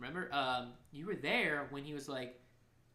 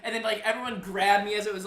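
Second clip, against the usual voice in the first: ordinary talk, nothing marked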